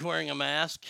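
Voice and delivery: usual speaking voice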